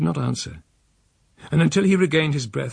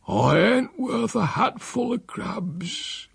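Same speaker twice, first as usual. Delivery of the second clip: higher, very slow, voiced as the sick old man; despairing old uncle; 10 semitones higher, much slower than usual. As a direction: drag it out with a rising lilt. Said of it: "I could hardly bear the words".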